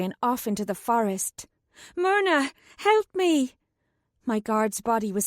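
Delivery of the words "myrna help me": higher, urgent call for help. A narrator's reading. Katrin calls to Myrna for help, urgent. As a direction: cry out to the girl for help.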